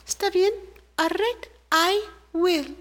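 high tiny ant voice agreeing; little ant. Acted. Little ant agreeing to go demand justice, high voice. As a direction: squeak a determined yes.

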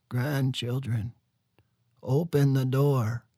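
put-on slow, small old man calling; transformed giant calling gently to his grandchildren; little voice. A narrator's reading. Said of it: "a little voice called from inside the sweat lodge".

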